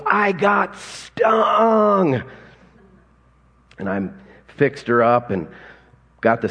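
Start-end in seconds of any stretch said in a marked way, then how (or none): 0.0-2.2 put-on a little girl's high, slow, wailing cry; crying child's shout. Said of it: "And she goes".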